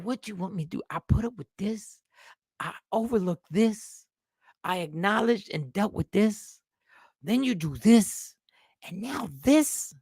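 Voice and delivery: woman reaching her limit, lightly played; lightly voiced fed-up woman. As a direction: tick off each grievance, tired and hurt.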